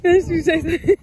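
delighted shout; excited. Real, not acted. Excited chasing puppy.

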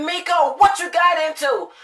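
high, loud demand at the dog; retold alarmed scolding. Retelling confronting the dog after coming back, loud scolding. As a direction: call out sharply, as if catching a culprit.

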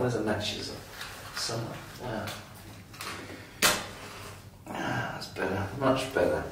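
soft fumbling mutter in the dark; quiet nervous mumbling; muttering to himself. Acted. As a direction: grope for the switch, murmuring.

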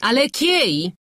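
high, loud yelled question. A narrator's reading. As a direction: yell it at them impatiently. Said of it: "wrzasnęła".

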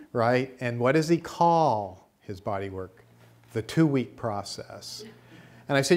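ordinary talk, nothing marked

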